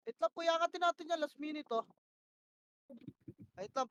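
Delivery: ordinary talk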